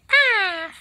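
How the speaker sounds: very high, loud little cry; happy Pokemon squeak. Acted. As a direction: squeal like a grateful tiny pet. Creature thanks the parent.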